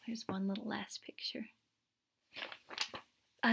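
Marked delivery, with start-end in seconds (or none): 0.1-1.5 whisper. Real, not acted. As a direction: whisper it like bedtime. Whispering near the story's end.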